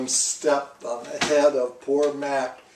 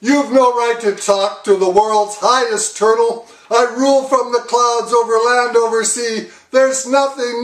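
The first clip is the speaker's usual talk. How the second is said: proud shouting; 8 semitones higher, 7 dB louder than usual. A narrator's reading. King shouting down at Mac.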